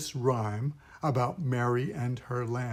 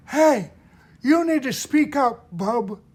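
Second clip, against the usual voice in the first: much higher and much louder barking order; 10 semitones higher, 8 dB louder than usual. Shown as a demonstration. Bossy character telling Timmy to speak up, high loud bark. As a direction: bark the order shrilly at the kid. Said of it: "the five core sounds".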